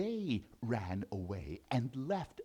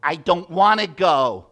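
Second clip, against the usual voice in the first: much louder protesting whine; loud mock tantrum whine; loud whiny refusal; 3 semitones higher, 16 dB louder than usual. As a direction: shout your refusal.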